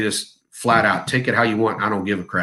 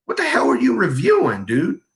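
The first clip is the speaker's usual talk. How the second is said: ordinary talk, nothing marked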